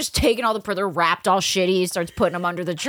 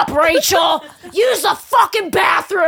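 higher, louder, slowed mock yell; mom's shout; 11 semitones higher, 8 dB louder, slower than usual. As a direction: bark the order at the kid. Mom shouting at friend.

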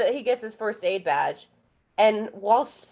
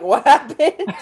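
mock dazed; 5 semitones higher, 7 dB louder, slower than usual. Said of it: "and you're like".

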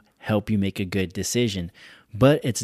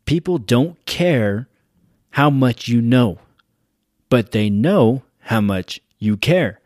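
slow and louder, each phrase landed; slow, loud, weighty quote; 5 dB louder than usual. Shown as a demonstration. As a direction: leader telling his audience a rule to live by, slow and emphatic. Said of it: "this is going to stick with you".

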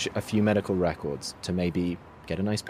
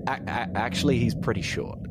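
ordinary talk

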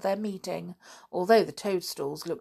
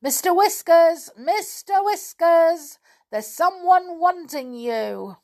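high, loud rabbit call; calling down the hole; 11 semitones higher, 6 dB louder, slower than usual. A narrator's reading. Rabbit calling the elf up from below, loud call. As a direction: call down into the burrow. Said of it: "called down his hole".

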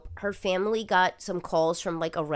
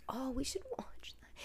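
whispered, softer suggestion; excited whisper; 4 semitones higher, 16 dB quieter than usual. Real, not acted. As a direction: whisper it eagerly.

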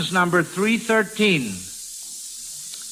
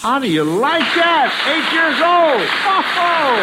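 much higher, amazed and delighted; delighted amazement; 9 semitones higher, 4 dB louder than usual. Real, not acted. Host amazed at eight-year-old.

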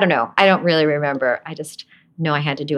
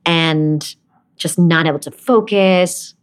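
ordinary talk, nothing marked

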